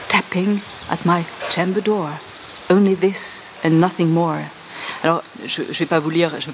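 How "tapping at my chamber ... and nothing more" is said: poem recital. Shown as a demonstration. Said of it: "c'est les sonorités".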